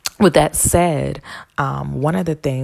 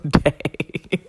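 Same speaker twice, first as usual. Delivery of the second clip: ordinary talk, nothing marked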